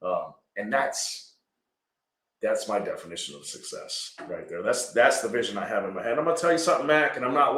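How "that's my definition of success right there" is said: normal talking voice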